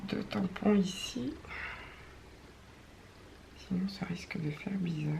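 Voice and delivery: unvoiced whisper, thinking aloud. Real, not acted. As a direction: whisper your thoughts to yourself. Muttering to herself while crafting.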